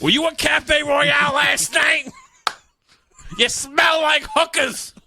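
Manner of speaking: bust him for the night out; accusing wife. Pretend. Wife voiced accusing husband.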